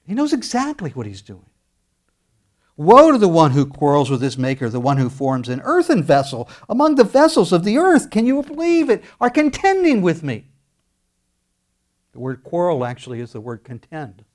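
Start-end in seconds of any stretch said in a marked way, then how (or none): 2.8-8.1 loud, ringing scripture read; preaching scripture. Acted. Preacher reading the verse to his congregation, proclaiming loudly. 8.1-10.5 amazed exclamation. Real, not acted. Preacher exclaims.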